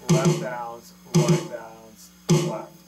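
loud, rhythmic calling of each stroke; counting the sticking aloud. Shown as a demonstration. Drum teacher calling out the sticking over his playing, loud and rhythmic. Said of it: "I'm doing a five stroke roll".

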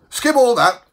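loud, high impatient interruption; hasty cry. Acted. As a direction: cut in impatiently at full voice. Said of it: "cried the bellman in haste".

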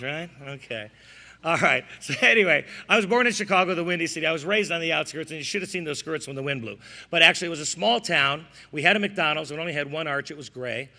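usual speaking voice

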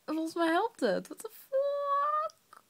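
high drawn-out disbelief; amazed. Real, not acted. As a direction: stretch it out in shocked joy. Hiccups gone, amazed. (ongelovig verbaasd)

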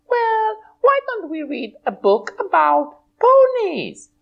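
high bright pony voice; a cheerful high puppet pony. Acted. Twilight puppet answering host, high pony voice.

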